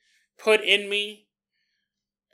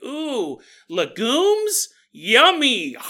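higher, hammed-up craving for legumes; mock delight; 6 semitones higher, 5 dB louder, much slower than usual. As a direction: swoon over raw legumes like a treat. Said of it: "and I was like".

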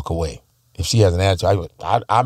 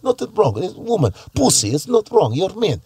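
Russian woman impression; 2 semitones higher than usual. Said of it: "when I was in Russia, they're like".

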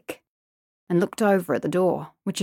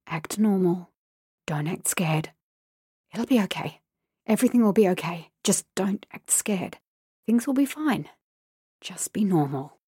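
hushed inner voice. Acted. Inner self-talk.

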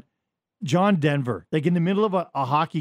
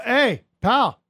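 loud, raised-pitch retold comeback; combative retort; 5 semitones higher, 5 dB louder than usual. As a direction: talk back to an irate coach, acting it out. Retold retort to the angry coach.

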